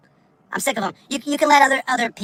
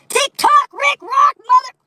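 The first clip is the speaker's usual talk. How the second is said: high loud mock yell; playful shout; 7 dB louder, much slower than usual. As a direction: yell it in comic frustration. Mock shouting at the app name.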